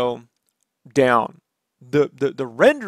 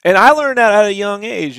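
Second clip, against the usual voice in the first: ordinary delivery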